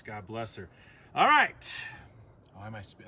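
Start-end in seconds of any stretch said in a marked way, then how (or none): 1.1-1.8 high loud all right. Real, not acted. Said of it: "I'm speaking so loud?".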